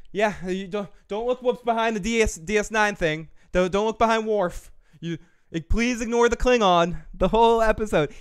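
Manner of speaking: begging everyone not to look; mock pleading.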